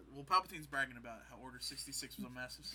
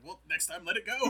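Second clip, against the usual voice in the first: loud snide retort; 4 semitones higher, 10 dB louder than usual. Retold or imitated. Palpatine retort, retold.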